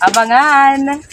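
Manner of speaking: loud, high, stretched-out teaser; drawn-out call. Real, not acted. Teasing viewers to watch out.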